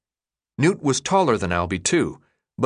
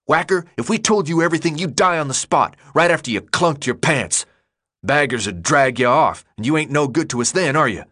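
fast, raised, heated tirade; angry rant; 5 semitones higher than usual. A narrator's reading. Leader berating the newcomer who interrupted, angry rant. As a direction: berate him in a quick rush. Leader's shouted rebuke continues.

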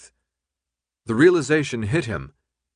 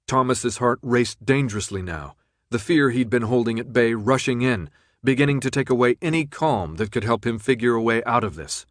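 ordinary delivery, nothing marked

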